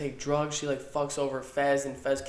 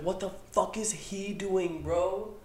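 stunned reaction; 6 semitones higher, slower than usual. Retold or imitated. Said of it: "I was like".

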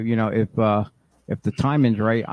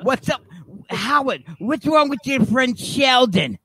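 Howard's mom voice; 9 semitones higher than usual. Pretend.

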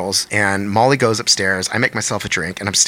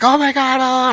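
retold scream; 13 semitones higher than usual. Friend Molly running downstairs, imitated screaming. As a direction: imitate a panicked scream well up in pitch. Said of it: "screaming, like".

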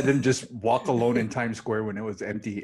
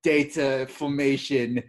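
Russell Brand impression; 3 semitones higher, 3 dB louder, slower than usual. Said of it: "Russell Brand impression".

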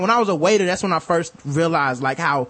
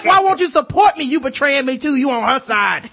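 laughing high-pitched parody of an offended friend; mock outraged Karen; 10 semitones higher, 5 dB louder than usual. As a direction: ham up an indignant coworker through laughter. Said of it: "She was like".

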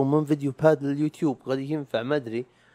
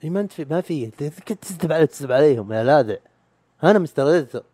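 higher, louder teasing accusation; mock accusing; 4 semitones higher, 6 dB louder than usual. An imagined listener exposing his fake Mister Editor, mock accusing. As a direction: call yourself out playfully, loud.

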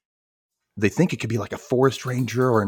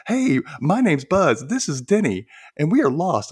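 hammed-up singsong introduction, pitched up; naive boy introducing himself; 7 semitones higher, 4 dB louder than usual. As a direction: imitate a clueless kid saying hello.

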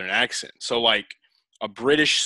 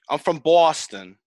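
put-on old Boston woman; 3 semitones higher, 4 dB louder than usual. Shown as a demonstration. Host showing guest the role, imitated Boston accent. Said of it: "an old Boston woman?".

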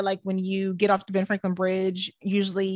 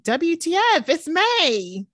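higher, slow spelled-out disbelief; exasperated; 8 semitones higher, 4 dB louder, much slower than usual. Real, not acted. Friend griping about mandates returning, exasperated. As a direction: protest it in drawn-out disbelief.

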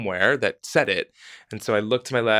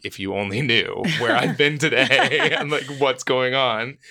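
half-laughing, higher and louder; higher, laughing "if you only knew"; laughing disbelief; 4 semitones higher, 3 dB louder than usual. Retold or imitated. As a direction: laugh through the wild day you've had. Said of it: "And I was like".

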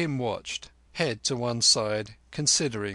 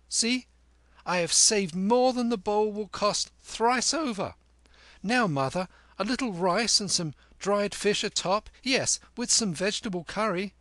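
put-on much higher, louder bragging boy; triumphant boy Kim; 10 semitones higher, 4 dB louder than usual. A narrator's reading. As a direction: crow over the victory like a cheeky kid. Kim's boyish voice.

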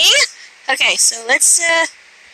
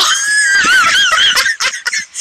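very high squealing laugh; piercing giggle fit; shrieking laughter. Real, not acted. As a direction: shriek with laughter at the startled cat. Cat leaps, speaker shrieks laughing.

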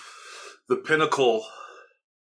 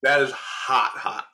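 burning mouth; much slower than usual. Real, not acted. Reacting to spicy wing.

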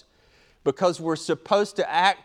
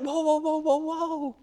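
alarmed backing off; 12 semitones higher, 3 dB quieter than usual. Retold or imitated.

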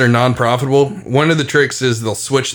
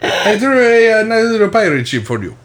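con artist vendor voice; 8 semitones higher than usual. Retold or imitated. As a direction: sell it like a smooth con man. Mock rice vendor, far.

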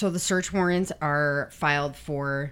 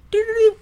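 high, loud little electronic alert jingle; mimicked call notification chime; imitated alert tone; 10 dB louder, faster than usual. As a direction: sing out a quick screen alert. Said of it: "We get this little, like".

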